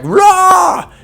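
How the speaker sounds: very high, shouted monster roar; mummy roar. Retold or imitated. The mummy roaring at Rick, high shouted mock roar. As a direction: roar like an angry undead mummy. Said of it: "the mummy's like".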